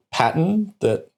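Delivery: ordinary talk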